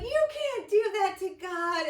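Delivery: high, loud, slowed-down outrage; shocked protest. Retold or imitated. Friends scolding Jeff for testing God, high and shocked. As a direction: gasp at the audacity, drawn out. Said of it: "And they're like".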